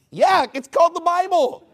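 high, loud incredulous shout; exasperated pulpit yell; indignant retort. Real, not acted.

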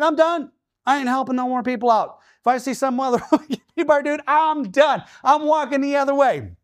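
high, exasperated vow to quit helping; a man swearing off helping anyone ever again, pitched high; fed up. Retold or imitated. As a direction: throw up your hands, done with it.

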